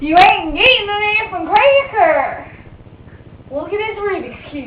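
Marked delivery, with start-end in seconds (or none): 0.1-2.5 loud, furious yell at the bird; angry yell. Real, not acted. Mother yelling at the cursing parrot, loud and angry. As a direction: shout the bird down.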